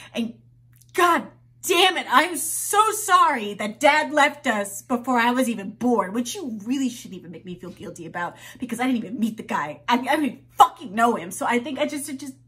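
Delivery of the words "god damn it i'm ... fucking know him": loud, fast, furious rant; angry outburst. Acted. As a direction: let the anger burst out.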